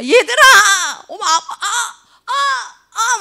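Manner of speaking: high, laughing cries of pain; half-laughing groans of a tired parent; mock pained cries. Preacher retells begging his kids to stop, half laughing. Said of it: "진짜 너무 힘들구나".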